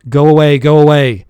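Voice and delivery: loud, urgent repeated order; desperate command. A narrator's reading. Master desperately ordering servant away, loud. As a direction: push him out, desperate. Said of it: "I commanded in desperation".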